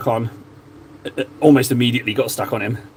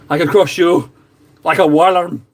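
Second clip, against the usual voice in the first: Braveheart quote; 4 semitones higher, 4 dB louder than usual. Retold or imitated. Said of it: "the bit in Braveheart where it's like".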